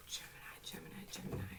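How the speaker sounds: soft unvoiced whisper. Real, not acted. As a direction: breathe the names out. Whispered sign names.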